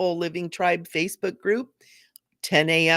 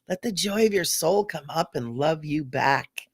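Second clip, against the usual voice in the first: ordinary talk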